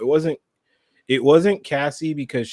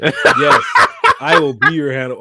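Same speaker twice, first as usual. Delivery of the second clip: loud, through real laughter; delighted, laughing agreement; laughing acceptance; 3 semitones higher, 9 dB louder than usual. Real, not acted. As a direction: say yes while cracking up.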